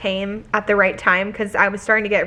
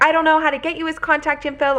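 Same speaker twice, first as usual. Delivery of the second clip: high, hurried imitation of a flustered man; spiraling; 7 semitones higher, faster than usual. Old man asked for son-in-law's contact, imitated spiraling. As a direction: rush it at a raised pitch, mimicking his fluster. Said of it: "he was just really spiraling".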